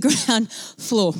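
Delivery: ordinary talk, nothing marked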